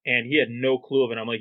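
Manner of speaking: ordinary delivery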